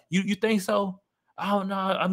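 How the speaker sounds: high, coy mock woman's voice; imitating a woman. A woman fishing for her friends' approval, mock coy.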